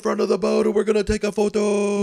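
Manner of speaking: stretched-out, high-pitched party-boat shout; mock hype-man scream. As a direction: imitate a boat hype man hollering to the crowd. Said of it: "Like, just screaming".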